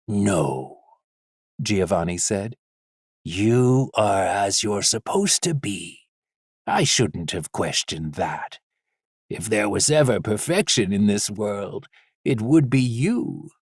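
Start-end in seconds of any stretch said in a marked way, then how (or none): 0.1-0.8 low, gentle no. Acted. Father reassures son softly. 3.2-13.5 tender reassurance. Acted. Father reassures son tenderly.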